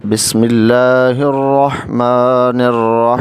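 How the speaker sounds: slow, drawn-out tajweed recitation; Quran recitation. Shown as a demonstration. Teacher reciting the verse for a prayer course, slow tajweed recitation. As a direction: chant each word slowly and melodically. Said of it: "آئیے ہم سورہ فاتحہ کی آیت number دو اور تین کو شروع کرتے ہیں".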